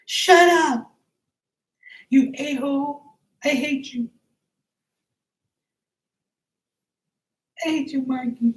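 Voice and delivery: put-on high-pitched childish insults; mock angry girl. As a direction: shriek like a sulky girl yelling at Marky. Acted angry girl insulting Marky.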